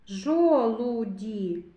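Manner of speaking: "Жолуді" slowly stretched, vowels held; sound-by-sound. Shown as a demonstration. Teacher picks out a vowel, "Жолуді" drawn out. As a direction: draw out "Жолуді" so the у is heard.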